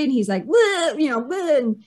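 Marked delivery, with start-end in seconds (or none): 0.5-1.0 higher-pitched "bleh" imitating puking; cartoonish puking noise; mock retching. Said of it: "puking. That's all you could hear". 1.2-1.6 higher-pitched "bleh" imitating puking; cartoonish puking noise; mock retching. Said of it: "puking. That's all you could hear".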